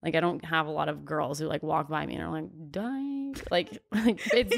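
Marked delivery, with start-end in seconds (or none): 2.7-3.5 high, soft passing jeer; mocking name-call. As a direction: imitate a quiet high-pitched taunt from a passer-by. Imitating girls muttering in passing.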